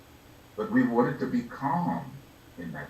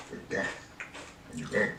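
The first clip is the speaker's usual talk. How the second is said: hushed; 2 semitones lower, 9 dB quieter, faster than usual. Acted. Solemn reminder of death.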